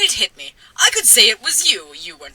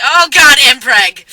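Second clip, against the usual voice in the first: loud flustered outburst; 3 semitones higher, 8 dB louder, slower than usual. Real, not acted. Flubbed line, exclaiming at mistake.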